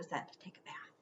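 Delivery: quick hushed whisper. A narrator's reading. Narrator whispering the ghost is sent to bathe. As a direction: rush it out softly.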